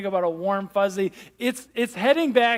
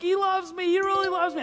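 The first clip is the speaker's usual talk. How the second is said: imitating a choked-up, much higher voice; retold tearful joy; 9 semitones higher than usual. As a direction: relive the teenage boy overwhelmed in tears. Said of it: "I'm, like, crying uncontrollably".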